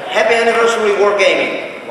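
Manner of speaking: loud, high celebratory cheer; rally the crowd with a big cheer; festive shout. Shown as a demonstration. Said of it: "we have to do it as one voice".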